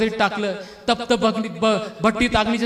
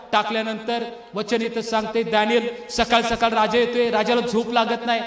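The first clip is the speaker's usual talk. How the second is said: ordinary delivery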